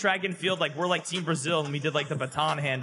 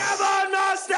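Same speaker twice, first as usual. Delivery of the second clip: high, loud cheer; excited shout; 4 dB louder, slower than usual. Real, not acted. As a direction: yell it to your teammate. Teammate cheering goal.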